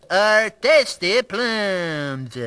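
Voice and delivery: very slow, stretched French accent. Shown as a demonstration. Practising phrases from a language course, French accent. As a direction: drag each word out in mock French. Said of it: "I'm learning the French accent".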